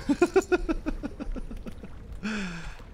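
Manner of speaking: long high-pitched laugh, trailing off; real high, drawn-out giggle. Real, not acted. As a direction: burst out laughing, then let it fade. Amused by viewer's comment.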